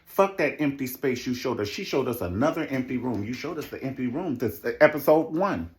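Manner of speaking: ordinary delivery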